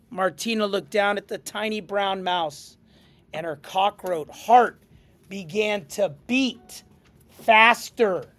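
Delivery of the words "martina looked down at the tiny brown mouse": ordinary delivery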